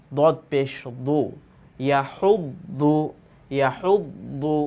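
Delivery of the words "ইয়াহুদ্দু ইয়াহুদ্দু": ইয়াহুদ্দু said slowly with the doubled দ held; teacher model of ইয়াহুদ্দু, unhurried; slow drill. Shown as a demonstration. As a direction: read ইয়াহুদ্দু slowly so the shadda is clear. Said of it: "তাজদিদযুক্ত শব্দের অনুশীলন".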